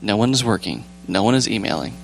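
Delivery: ordinary talk, nothing marked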